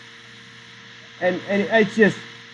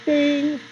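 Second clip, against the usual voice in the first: bell imitation; 9 semitones higher than usual.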